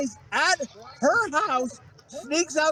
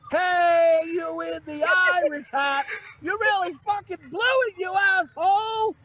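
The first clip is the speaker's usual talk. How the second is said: stretched-out mock shriek of outrage, pitched way up; ranting yell; 7 semitones higher than usual. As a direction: imitate a furious girl hollering someone down. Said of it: "this bitch is still rambling on".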